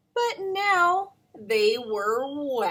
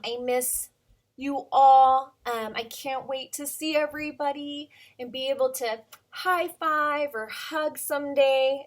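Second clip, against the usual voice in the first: normal talking voice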